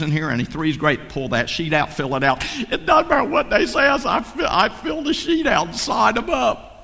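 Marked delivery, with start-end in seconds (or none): 2.7-6.7 high excited voice. Acted. Acting his own eager response.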